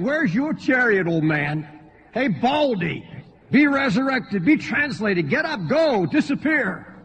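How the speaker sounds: high-pitched, jeering challenge; mocking taunts. Young men taunting Elisha about Elijah's chariot, mocking. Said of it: "they are literally mocking this man".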